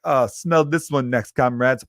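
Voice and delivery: put-on hammy Russian accent, stretched out. Russian farter warns his comrades, comic Russian accent. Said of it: "if that was a good Russian accent".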